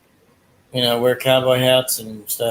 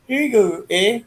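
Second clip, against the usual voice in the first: high Canadian accent; a light Canadian door-holder; 8 semitones higher than usual. Shown as a demonstration. Describing Canadians holding doors, high Canadian accent. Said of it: "people do talk like that".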